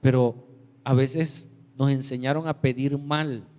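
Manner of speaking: normal talking voice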